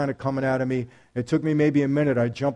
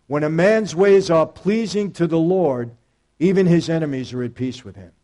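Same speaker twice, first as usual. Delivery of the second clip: solemn scripture reading; 3 semitones higher, 6 dB louder than usual. Shown as a demonstration. Reading the verse aloud.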